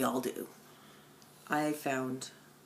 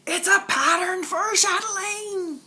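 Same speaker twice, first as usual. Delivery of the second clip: much higher and louder, overjoyed; thrilled squeal; 6 dB louder than usual. Real, not acted. Vlogger telling viewers about the gift from Jen, thrilled high squeal. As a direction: burst out with delight.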